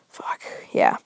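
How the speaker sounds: breathy unvoiced whisper; whispered aside. Real, not acted. Telling listeners about his stomach, whispered. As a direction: drop to a whisper.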